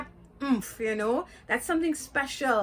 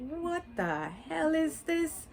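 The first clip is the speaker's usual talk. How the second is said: slow, put-on incredulous voice; baffled disbelief; 5 semitones higher, 4 dB quieter, slower than usual. Fans of his age react to the story, slow mock bafflement. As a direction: act out a confused fan asking what this is. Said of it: "gonna be like".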